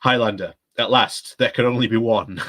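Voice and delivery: put-on hammy Highlander quote in a mild Scottish Connery accent; Sean Connery impression. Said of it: "that's my Sean Connery impression".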